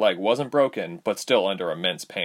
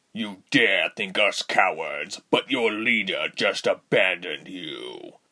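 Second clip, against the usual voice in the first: angry villain. A narrator's reading. Rubens voiced.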